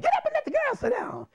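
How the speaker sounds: very high, mock-furious command; high-pitched caricatured outburst; irate principal's repeated order. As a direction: screech the repeat as the enraged principal. Principal repeats order while beating pupil.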